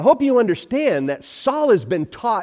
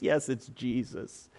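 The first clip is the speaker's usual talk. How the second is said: slow, much softer admission; resigned hush; 6 semitones lower, 14 dB quieter, slower than usual. Acted. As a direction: give in, barely aloud.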